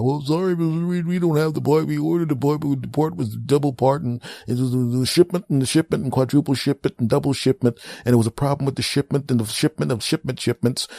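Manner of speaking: high, rambling excuse-making rep voice; high mock customer service voice; babbling company rep. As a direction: pile up nonsense excuses cheerfully.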